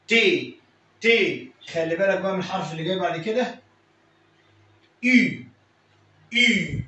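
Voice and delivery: ordinary talk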